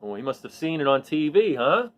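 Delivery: plain reading voice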